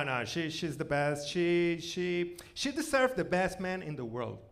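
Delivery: ordinary talk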